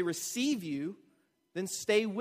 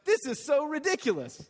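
exasperated Peter; 7 semitones higher than usual. Retold or imitated. Preacher voicing Peter waving off the mockers, higher scoffing. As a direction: throw it off like it's laughable. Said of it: "his point is, like".

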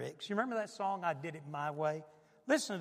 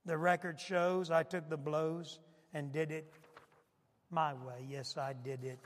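dramatic lyric recitation, swelling in places; 5 dB louder than usual. Retold or imitated. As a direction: recite the song with rising passion. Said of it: "I ran this off, [name]'s My Way lyrics".

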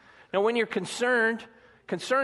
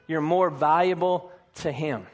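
ordinary talk, nothing marked

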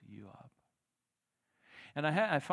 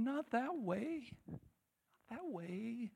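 crying plea; 10 semitones higher, 3 dB quieter, much slower than usual. Acted. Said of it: "crying and going".

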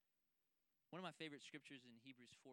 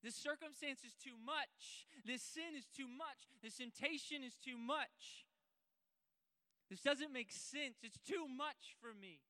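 higher, louder, slow cry of a struggling believer; anguished prayer; 10 semitones higher, 10 dB louder, slower than usual. Pretend. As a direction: pour out your pain to God. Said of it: "Just tell him".

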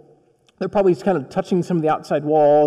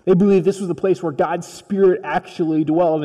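ordinary talk, nothing marked

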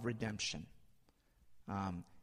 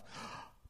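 sharp gasp of realization; panicked gasp; 13 dB quieter than usual. Acted. Preacher acts out stark realization of wrongdoing, panicked gasp. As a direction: suck in a sudden breath.

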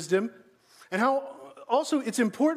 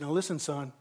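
lower-pitched, earnest fatherly urging; imagined serious dad; 5 semitones lower than usual. Acted. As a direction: lean in as a dad with grave advice. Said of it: "Dad's really serious here".

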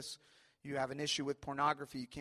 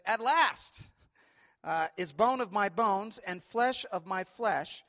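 slow, higher, louder proclaiming of Adam's words; scripture reading; 5 semitones higher, 7 dB louder than usual. Acted. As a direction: announce it like a joyful discovery.

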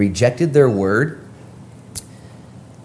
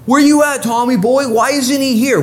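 high, raised-voice demand for an absentee; mock calling out; 13 semitones higher, 4 dB louder than usual. Preacher playfully calls out the absent Thomas, mock demanding. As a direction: call out sharply for a missing buddy.

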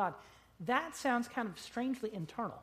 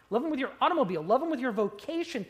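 ordinary talk

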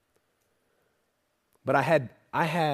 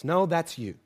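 ordinary delivery

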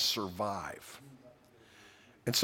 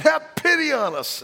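high, loud plea on pity; pleading cry; 8 dB louder than usual. Retold or imitated. Lepers begging Jesus again, high loud plea. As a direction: beg him loudly for mercy. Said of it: "they said".

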